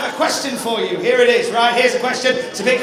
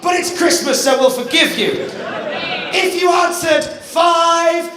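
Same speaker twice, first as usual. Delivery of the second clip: hyped presenter; 4 semitones higher than usual. Acted. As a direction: announce answers with showmanship. Presenter quiz reveal.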